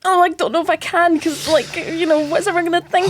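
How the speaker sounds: high, dithering imitation of a nervous lad; hesitant young writer. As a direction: play him unsure, pitched well up.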